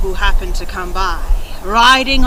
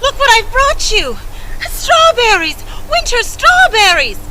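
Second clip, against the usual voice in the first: much higher, bright shout of joy; excited girl; 11 semitones higher, 3 dB louder than usual. A narrator's reading. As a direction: burst in thrilled with the gift.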